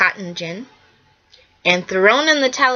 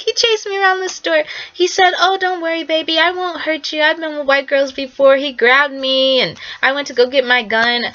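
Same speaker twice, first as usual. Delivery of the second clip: much higher, put-on lying woman's voice; mock her false testimony, pitched up; lying witness; 7 semitones higher than usual. Carolyn Bryant lying on the stand, high mocking voice. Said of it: "Carolyn Bryant lied on the stand. She's like".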